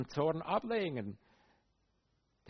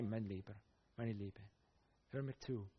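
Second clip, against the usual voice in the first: tender Jesus; 4 semitones lower, 9 dB quieter than usual. Retold or imitated. Jesus voiced to his disciples.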